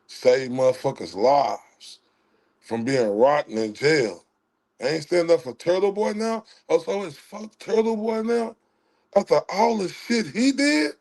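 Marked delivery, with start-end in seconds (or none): 4.8-10.9 higher-pitched rhetorical questions, climbing at the ends; indignant disbelief. Real, not acted. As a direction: throw each question out in rising disbelief. Challenging disloyalty to Turtle Boy.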